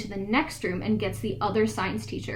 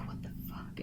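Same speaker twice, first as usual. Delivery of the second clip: a breathless, horrified whisper; stunned whisper; 16 dB quieter than usual. Retold or imitated. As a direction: whisper it, frozen in shock. Friends shrinking back in terror.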